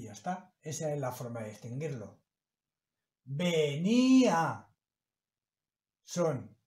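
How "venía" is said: "Venía" slowly and louder, ve-ní-a, the í and a split apart; syllable demo. Shown as a demonstration. Teacher sounding out a hiatus example for students, slow and clear. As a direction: stretch "Venía" so the í and the a fall in separate syllables. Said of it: "se pronuncian las dos vocales en sílabas diferentes".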